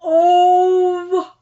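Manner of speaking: normal talking voice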